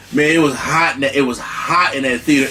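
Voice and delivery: usual speaking voice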